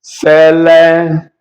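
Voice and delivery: Celebra- very slowly, in long held syllables; cele, pause, bra. Shown as a demonstration. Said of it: "Celebration is also very simple".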